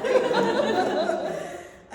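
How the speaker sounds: high burst of real laughter; loud laugh. Real, not acted. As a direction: crack up. Laughing at the bacon story.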